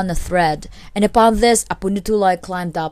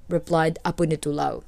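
ordinary delivery, nothing marked

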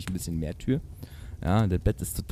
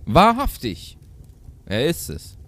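raised, loud exclamation dropping to normal voice; goat mother calling; 4 semitones higher, 5 dB louder, slower than usual. A narrator's reading. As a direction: call out with only a hint of character. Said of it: "rief die Geißenmutter". (leicht gespielter Ausruf der Geißenmutter)

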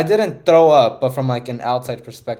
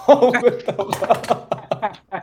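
laughing disbelief; 9 semitones higher, 4 dB louder, much slower than usual. Real, not acted. Laughing disbelief at being called pro.

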